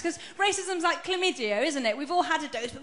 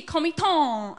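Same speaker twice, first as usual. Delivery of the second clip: "Comiton" in a drawn-out, put-on Chinese accent; overcareful fake Chinese pronunciation; mock Chinese accent; 4 dB louder, slower than usual. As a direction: repeat the name with an exaggerated Chinese accent. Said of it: "I added a Chinese accent to it".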